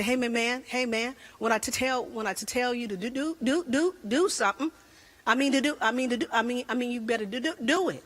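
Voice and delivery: mock stutter, jammed on "do". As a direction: imitate a stuttering dad giving orders. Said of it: "My husband stutters".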